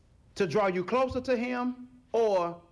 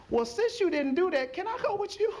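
high voice climbing at the end; wheedling plea; 10 semitones higher than usual. Acted. An onlooker asks Noah to take them along, wheedling. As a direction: play a hopeful tag-along, pitched up.

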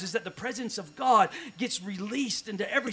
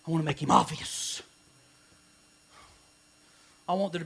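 preaching. Acted. Pastor preaching the dream to the church, full force. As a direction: preach it to the congregation.

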